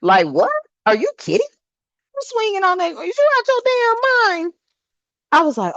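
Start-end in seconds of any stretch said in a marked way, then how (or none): 0.3-4.5 squeaky outraged what are you doing; mock shocked scolding. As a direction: pitch shooting way up in mock disbelief. Mock scolding kids on TikTok.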